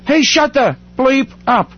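high-pitched imitation of yelling at a crowd; mock yell. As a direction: act out yelling for silence, pitch up without full volume. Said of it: "but yelled it way louder".

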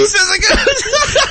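squeaky high giggling repeat, slow; laughing. Real, not acted.

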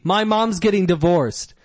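deadpan blurt of bad news; blurted comic line. Shown as a demonstration. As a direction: drop the news abruptly for the laugh. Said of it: "That's funny".

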